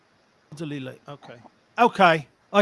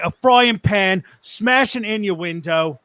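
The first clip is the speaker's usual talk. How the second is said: put-on Cockney accent; 2 semitones lower than usual. Giving the story's moral to cohosts, Cockney accent. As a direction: close the tale like a Cockney. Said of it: "and a Cockney accent".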